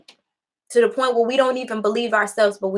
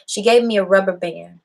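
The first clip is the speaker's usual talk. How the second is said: ordinary talk, nothing marked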